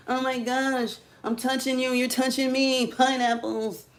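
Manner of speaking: high, giddy mock-drunk voice; tipsy act. Acting out a drunk girl on the loose, tipsy and high-pitched.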